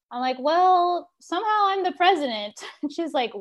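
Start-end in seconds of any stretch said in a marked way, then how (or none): 0.4-2.5 high-pitched, lightly acted confession; a touch of playful awkwardness, pitched up; sheepish admission. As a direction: break odd news to mom. Sheepish news to mom, acted.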